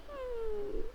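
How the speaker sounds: soft, high mock whimper; demonstrated crying. Shown as a demonstration. Teacher shows students the action for crying, mock whimper. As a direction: act out crying with a long high hum. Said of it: "and crying".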